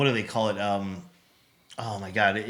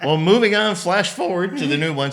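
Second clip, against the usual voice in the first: much higher and louder, laughing into a big transition; laughing segue; 9 semitones higher, 8 dB louder than usual. Real, not acted. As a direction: announce the next topic with a laugh. Mock grand segue while laughing.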